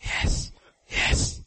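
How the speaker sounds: breathy whispered yes; whispered agreement. Retold or imitated. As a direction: murmur it like a secret thought. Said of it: "And you say".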